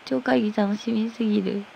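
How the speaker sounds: usual speaking voice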